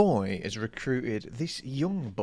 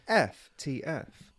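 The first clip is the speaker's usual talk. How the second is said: ordinary talk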